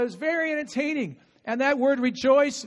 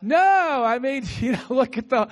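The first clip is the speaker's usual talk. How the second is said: ordinary delivery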